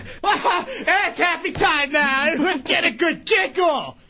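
high, hammed-up forced cheer; mock happiness. Upset man announces a good giggle, mock-cheerful shout. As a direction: shout fake glee, slow and high.

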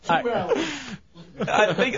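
high giggling, stretched slowly; embarrassed laugh. Real, not acted. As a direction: laugh it out awkwardly. Embarrassed laugh before answering.

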